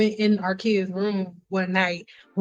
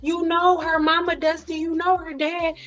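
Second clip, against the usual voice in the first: higher-pitched pleading on someone's behalf; excuse-making inner voice; 6 semitones higher than usual. Acted. Another part of her making excuses for someone, higher-pitched reasoning. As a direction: argue the other side, voice lifted. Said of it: "another part of me is like".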